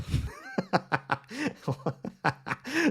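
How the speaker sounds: long, high-pitched burst of laughter; amused laugh. Real, not acted. Streamer laughing at his own bad accent, helpless laugh. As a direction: crack up at your own attempt.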